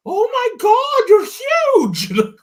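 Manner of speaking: very high, amazed squeal; squealing woman. Retold or imitated. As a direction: squeal it like a tiny astonished woman. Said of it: "And she's like".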